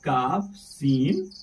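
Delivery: काफ सीन drawn out for pupils; name the letters काफ सीन slowly; slow letter naming. Shown as a demonstration. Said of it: "Urdu में हम इसको". (काफ सीन धीरे-धीरे बताते हुए)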